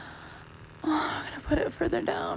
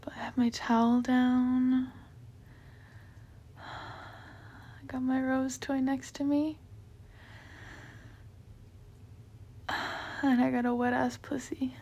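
soft intimate whisper; breathy close-mic whisper; 4 dB quieter than usual. Real, not acted. Intimate whispered talk throughout.

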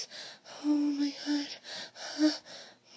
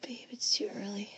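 whispering; faster than usual. Acted. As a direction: whisper it close to the ear.

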